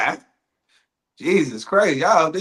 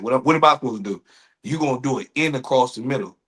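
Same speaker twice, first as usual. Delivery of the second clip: ordinary delivery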